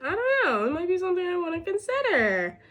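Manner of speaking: hopeful excitement. Retold or imitated. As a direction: imitate your giddy past self dreaming of a fellowship. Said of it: "I was like".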